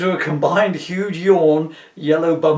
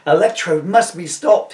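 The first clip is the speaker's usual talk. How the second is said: triumphant cry; 4 semitones higher, 8 dB louder than usual. Acted. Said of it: "he cries triumphantly".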